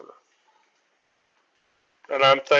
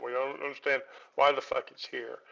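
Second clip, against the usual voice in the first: ordinary talk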